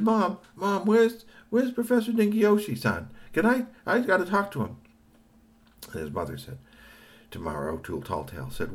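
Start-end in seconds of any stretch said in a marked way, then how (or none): 0.0-4.8 put-on high, urgent boy voice asking for the professor; a kid bursting in on his mom; excited boy.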